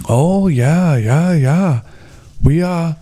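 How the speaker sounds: put-on lilting Minnesota-like accent; singsong mock Minnesota yeahs; imitated weird accent. Weird guide talking as if practising, imitated Minnesota-like accent. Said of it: "he was really weird".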